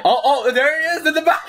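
high giggly excitement; excited laughing. Real, not acted. Watching near miss, cracking up.

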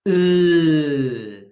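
"Uh" held long and loud; letter sound demo. Shown as a demonstration. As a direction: sound out "Uh" in one long, falling note. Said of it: "say the sound before I say it".

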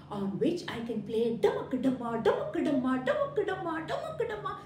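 rising playful dumma-ka-dumma chant; drum rhythm. Acted. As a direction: tap out the beat with your voice. Boy imitating drum sounds.

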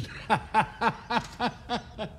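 high, light burst of laughter. Real, not acted. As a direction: laugh at the uncle's joke. Uncle's joke makes her laugh. (ضحكة عالية خفيفة)

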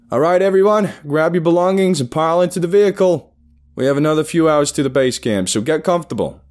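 loud, high-pitched orders to a crowd; boss calling out. A narrator's reading. Boss sending everyone into the vehicles, calling out. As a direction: call the group to the vehicles.

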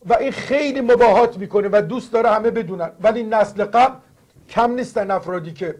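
normal talking voice

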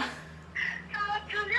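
high, crying out her name in tears of joy; sob-shout the name, overjoyed; tearful excited cry. Real, not acted. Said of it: "ma stai piangendo". (grido emozionato tra le lacrime)

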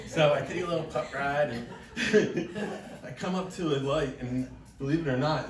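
ordinary talk

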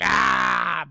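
loud grumpy "aah"; exasperated yell. Retold or imitated. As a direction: cut off the goodbye with a loud cry. Scrooge growls at driver's goodbye.

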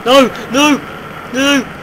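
high, loud frightened "no!"s; real panicked shouts. Real, not acted. Mob attacks him.

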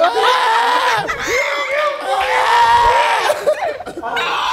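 loud high laugh. Real, not acted. Laughter at the bit.

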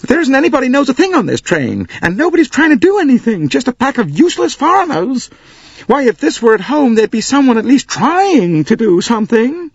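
high, loud wailing American lady. Acted. Missus Hubbard wailing to the passengers, high and loud.

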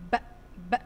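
isolated b sound, said twice; letter sound b. Shown as a demonstration. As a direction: clip the sound ب short, twice. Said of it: "صوت حرف الباء". (نطق صوت ب منفصل)

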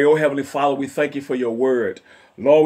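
usual speaking voice

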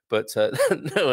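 normal talking voice

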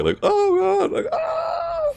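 high hammed-up shrieks; mock scream. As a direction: ham up a painful cry. Mocking the voice line.

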